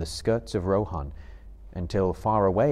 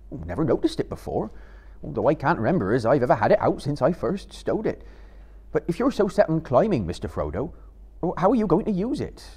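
Sam, quick and higher, fussing; doubtful Sam; 6 semitones higher, faster than usual. Acted. Sam questioning Frodo's climbing plan, fussing quickly.

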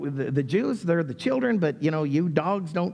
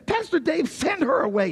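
higher, mildly brushing her off; dismissive colleague; 9 semitones higher than usual. Acted. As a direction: wave the woman away, lightly mocked. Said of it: "Pastor Sheldon says".

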